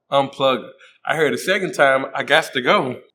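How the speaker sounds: normal talking voice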